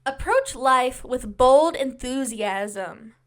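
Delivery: loud, very slow, emphatic read-out; proclaimed fortune reading. Real, not acted. Host giving YouTube viewers their reading, proclaimed. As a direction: proclaim it slowly and boldly.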